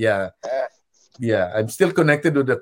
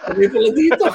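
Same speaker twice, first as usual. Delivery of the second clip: high, loud, amused; delighted realization; 10 semitones higher, 6 dB louder, much faster than usual. Retold or imitated. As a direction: say it like a happy discovery. Repeating with delight.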